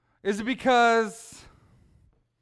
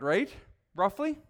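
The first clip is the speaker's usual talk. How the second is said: ordinary delivery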